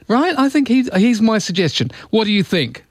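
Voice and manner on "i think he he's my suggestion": ordinary talk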